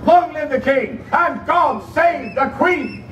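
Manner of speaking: ringing call to the crowd; ceremonial cheer. Acted. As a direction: proclaim it to the crowd. Herald calls the cheer.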